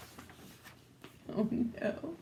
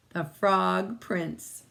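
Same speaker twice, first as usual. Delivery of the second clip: plain reading voice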